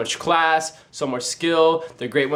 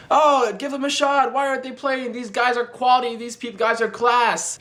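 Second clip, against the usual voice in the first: mock fan outcry, pitched way up but not shouted; mock screaming fans; 9 semitones higher than usual. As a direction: imitate fans pleading in an exaggerated high voice. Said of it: "everybody starts screaming".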